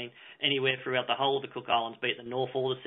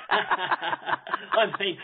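laughing. Real, not acted. Laughs at host's joke.

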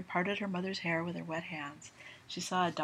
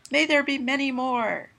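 festive toast; 8 semitones higher, 10 dB louder than usual. Acted.

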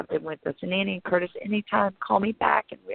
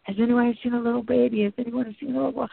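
retold frantic screaming; 6 semitones higher than usual. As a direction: yell for a lost child, higher and frantic. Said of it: "and I'm screaming".

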